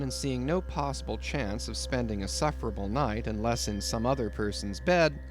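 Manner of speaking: plain reading voice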